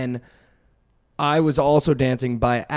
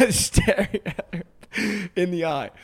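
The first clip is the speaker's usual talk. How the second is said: laughing; 4 semitones higher than usual. Real, not acted. Laughs telling the drink pour.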